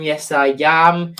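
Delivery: usual speaking voice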